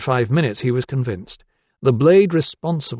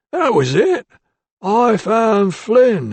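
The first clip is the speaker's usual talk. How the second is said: much higher, slow and emphatic; eager ironmonger; 10 semitones higher, 5 dB louder, much slower than usual. A narrator's reading. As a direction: eagerly confirm the name, drawn out.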